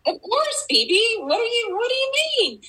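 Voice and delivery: very high, loud, gleeful reply; playful mock astonishment. Mentor answering her doubting student, playfully emphatic. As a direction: toss it back high and teasing.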